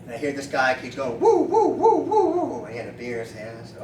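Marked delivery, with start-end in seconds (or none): 1.2-2.6 high, loud repeated whoops; imitated whooping. Man with a beer hollering in the street, high whoops. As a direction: series of falsetto woos.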